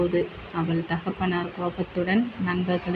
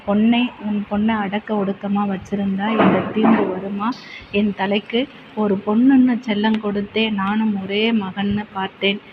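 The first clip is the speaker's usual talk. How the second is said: louder, heated argument between two fathers; angry quarrel; 5 dB louder than usual. Acted. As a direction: hurl blame back and forth. Fathers quarrel over eloping children.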